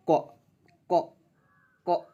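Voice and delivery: क cut short, without its अ vowel; clipped क, the vowel dropped; half क. Shown as a demonstration. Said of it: "ऐसे करके पढ़ेंगे".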